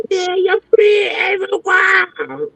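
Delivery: high, slow calling-out imitation; mock him still asking about her; mock his call-out.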